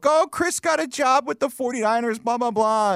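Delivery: high, gushing proud mom; a light proud-mom voice; proud mom post. Retold or imitated. Mom bragging about son's job on Facebook, high gushing. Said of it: "posted on Facebook".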